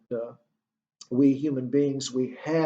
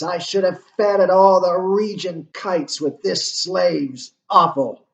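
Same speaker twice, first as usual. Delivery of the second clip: mounting, louder Shakespearean rant; agitated Hamlet; 3 semitones higher, 7 dB louder than usual. Acted. Lecturer reading Hamlet's soliloquy aloud to class, working himself up loudly. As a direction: let Hamlet work himself up until he loses control. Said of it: "Hamlet really works himself up here".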